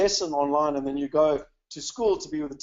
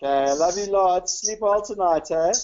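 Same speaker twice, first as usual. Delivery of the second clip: slow, lifted, fond sign-off; loving bedtime goodbye; 3 semitones higher, 4 dB louder, slower than usual. Real, not acted. Father wishing his son good sleep, loving and drawn-out.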